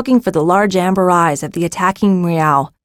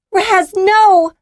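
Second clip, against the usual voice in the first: alarmed cry; 13 semitones higher, 4 dB louder than usual. A narrator's reading. Cries in the cave attack.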